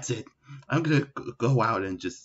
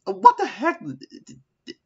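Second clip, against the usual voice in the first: startled; 7 dB louder than usual. Acted. Shadow wakes in a strange vision.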